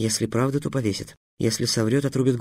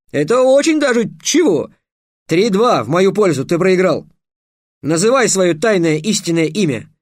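put-on much higher, loud, excited boyish voice; triumphant; 7 semitones higher, 7 dB louder than usual. A narrator's reading. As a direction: press the win home, almost shouting. Trix wins argument. (торжествующе, громко и высоко)

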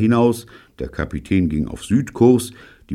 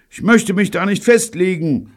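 very high, louder reply; evasive radio voice; 5 dB louder than usual. A narrator's reading. David asked for the exact position, high evasive calling. Child dodges question over radio.

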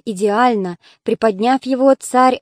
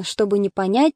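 ordinary delivery

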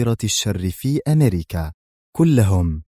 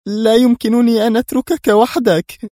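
high, louder, pleading through tears; tearful plea; 13 semitones higher, 6 dB louder than usual. A narrator's reading. Mona in tears begging to stay, tearful. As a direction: beg him not to send her away. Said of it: "عادت الدموع تسيل من عينيها".

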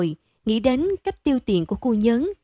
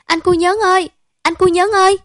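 high, loud panicked call from sleep; screaming out; 9 semitones higher, 7 dB louder than usual. Acted. Đức wakes and screams for his friend, high and loud. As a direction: yell for your friend, waking from a nightmare. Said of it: "gọi thét lên".

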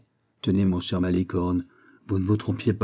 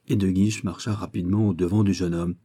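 usual speaking voice

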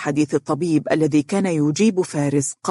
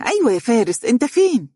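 put-on higher, louder young woman asking; young woman's voice; 7 semitones higher, 3 dB louder than usual. Dunya asking Faris on the phone, high young woman's voice. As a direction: call out to him as an anxious young woman. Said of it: "هتفت دنيا".

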